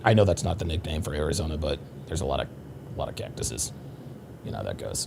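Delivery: trailing off into a near-whispered mutter; soft mumbled afterthought; quiet aside. Real, not acted. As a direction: drop the voice to a murmur for the joke. Mumbled aside about cactuses.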